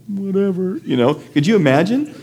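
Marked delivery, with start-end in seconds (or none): 0.1-0.8 high-pitched mock brush-off; dismissive disciples. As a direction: toss it off in a high, doubting voice. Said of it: "And they're all going".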